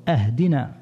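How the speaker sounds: أهدنا opened with an a, "ahdina"; misread opening vowel. Shown as a demonstration. Teacher showing how guidance becomes gift, أهدنا said "ahdina". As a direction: start أهدنا on "ah" instead of "ih". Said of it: "أو يقرأه".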